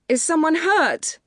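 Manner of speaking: high, loud, drawn-out shout; anxious call. A narrator's reading. Homeowner calling to a builder running over, anxious shout. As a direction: call out with panic held back. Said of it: "I call, trying to keep the panic out of my voice".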